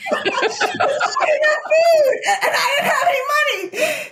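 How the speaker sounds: very high, slow, laugh-crying confession; half laugh, half sob at her own desperation; laughing through tears. Real, not acted. Confessing stealing a muffin.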